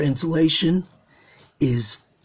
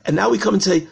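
normal talking voice

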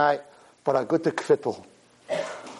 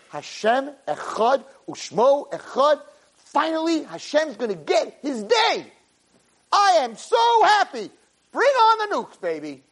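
ecstatic; 11 semitones higher, slower than usual. Pretend. Ecstatic preaching of joy.

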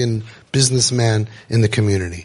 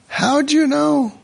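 much higher, drawn-out astonishment; slow mock wonder; 12 semitones higher, slower than usual. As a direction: stretch it in fake awe. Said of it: "F you, drop dead".

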